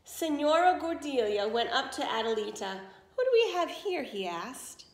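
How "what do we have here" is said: lightly voiced lady, higher; a touch of playful curiosity; curious greeting. Lady asks the disguised girl, lightly higher.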